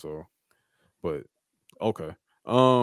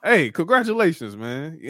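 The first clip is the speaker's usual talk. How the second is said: retold congratulations; 7 semitones higher, 4 dB louder than usual. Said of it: "And he's like".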